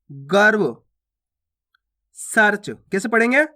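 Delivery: ordinary talk, nothing marked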